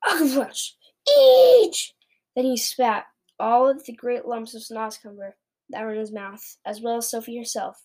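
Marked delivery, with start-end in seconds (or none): 0.0-1.9 loud high screech of revulsion; disgusted gag breaking into a screech; roar of disgust. A narrator's reading. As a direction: screech at a foul taste. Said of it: "roared the blood bottler".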